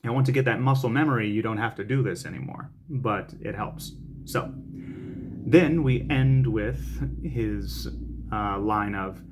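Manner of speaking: usual speaking voice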